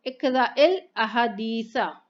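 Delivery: slow, linked इकरा इल अहदीसा with the हमज़ा dropped; teacher models joined reading इकरा इल अहदीसा slowly. Shown as a demonstration. Teacher shows students joined इकरा इल अहदीसा, slow and careful. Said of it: "join करके पढ़ना है हमें".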